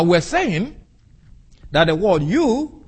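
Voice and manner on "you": "you" pitched high and set apart; pointed, emphatic "you"; stressed "you". Shown as a demonstration. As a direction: hit the word "you" high. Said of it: "is emphatic in the Greek".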